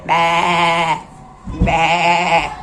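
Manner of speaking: low, drawn-out baa; sheep bleat. Pretend. Imitating the sheep's sound.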